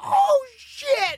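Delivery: very high, drawn-out mock crowd cry; high hammed-up whoop; cheering crowd. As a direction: imitate a whole crowd reacting. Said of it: "And the crowd's like".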